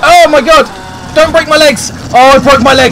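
high, loud yell of alarm; panicked yell. Real, not acted. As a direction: cry out in shock and panic. Falls and breaks legs fleeing.

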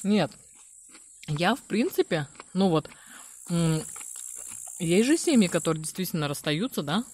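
chewing between words; mouth full. Real, not acted. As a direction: food at lips. Seen 2: Woman bites greens from chopsticks, chews and talks, bites again.

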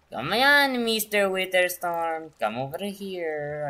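loud, high teasing call-out; taunting call. Real, not acted. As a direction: goad the monster to come over. Taunting the boss to come.

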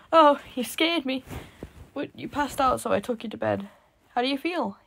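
put-on high, loud boy voice, startled. A narrator's reading. Startled boy asks the narrator how they feel, high loud. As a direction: jump then check in kindly.

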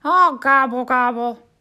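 loud howling gobble; howling turkey gobble. Acted. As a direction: howl in frustration. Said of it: "howled Turkey".

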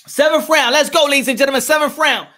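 high, loud ring-side hype; excited fight commentator calling the round; round announcement. Real, not acted. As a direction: kick off the round with energy. Announcing the start of seventh round.

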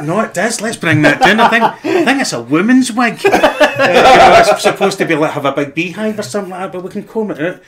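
high, loud and laughing; giddy stylists laughing at their own idea; excited hair crew. Retold or imitated. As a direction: pitch the wig idea through giggles. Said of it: "And they were like".

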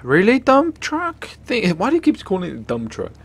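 incredulous, exasperated gamer rant; exasperated disbelief. Real, not acted. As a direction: whine at the game in disbelief. Exasperated at failed stunt.

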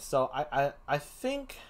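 normal talking voice

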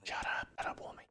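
whispered hiss to shut up; quiet it down mid-stealth; whispered rebuke; hushed shut up. Real, not acted.

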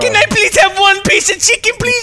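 begging. Acted. Begging for chicken in game.

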